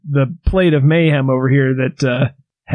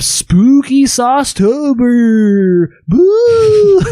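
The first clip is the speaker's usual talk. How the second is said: spooky announcer; 9 semitones higher, 4 dB louder, much slower than usual. Acted.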